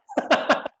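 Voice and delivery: short, high, breathy laugh. Real, not acted. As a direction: break off laughing at the memory. Laughs at own story.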